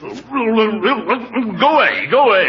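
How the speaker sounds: sleepy mumble. Acted.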